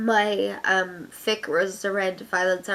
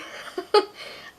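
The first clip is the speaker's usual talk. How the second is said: short laugh; 13 semitones higher than usual. Real, not acted. Laughs after sarcastic remark.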